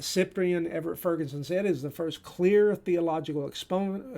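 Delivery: normal talking voice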